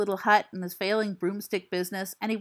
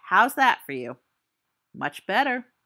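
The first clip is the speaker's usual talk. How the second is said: normal talking voice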